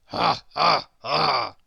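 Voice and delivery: glum, heavy ha ha ha; miserable flat laugh. Acted. Grizzle copying Squeak's laugh, miserable forced ha ha. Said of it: "said Grizzle miserably".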